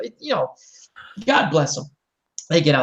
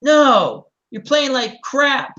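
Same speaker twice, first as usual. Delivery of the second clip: blunt scolding; 8 semitones higher, 4 dB louder, much slower than usual. Retold or imitated. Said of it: "This is exactly how I told them".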